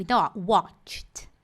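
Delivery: watched whispered slowly, tch then t; English watched. Shown as a demonstration. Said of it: "faz um tch e depois um t".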